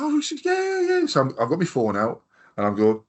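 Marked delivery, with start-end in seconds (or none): 0.0-1.1 very high, excited imitation of the DJ; mimicking the DJ. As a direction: squeal it like an eager fan. Imitating the DJ's eager reply.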